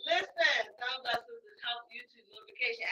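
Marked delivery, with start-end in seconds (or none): none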